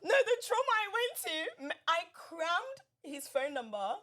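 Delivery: high, loud laughing recollection; real laughter while retelling; laughing trauma. Real, not acted. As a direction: laugh through the memory of panic. Laughing about stressful memory.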